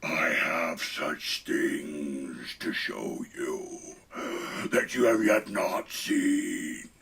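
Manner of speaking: low, slow, menacing horror villain voice; low creeping Hellraiser threat; Pinhead. Acted. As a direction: whisper it like a demon at the door. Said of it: "I figured I'd bring Pinhead in".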